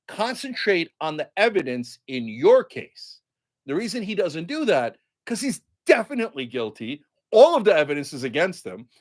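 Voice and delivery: normal talking voice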